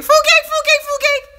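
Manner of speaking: put-on high squeaky toddler chant of fuk eik; child's mispronunciation. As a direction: imitate a small kid yelling fruit cake. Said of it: "my son just started saying fruit cake".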